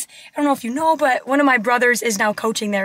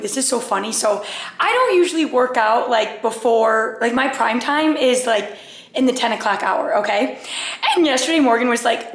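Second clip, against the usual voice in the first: ordinary talk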